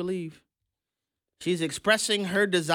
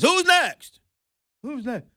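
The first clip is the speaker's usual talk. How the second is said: put-on high, confused old man voice; mocking a confused Biden calling out; 8 semitones higher, 4 dB louder, slower than usual. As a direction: play a lost, doddering president.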